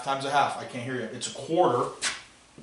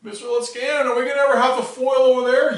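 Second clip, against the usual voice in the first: higher-pitched, louder mock student question; imitated student; 7 semitones higher, 4 dB louder, faster than usual. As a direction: pipe up like a student interrupting class.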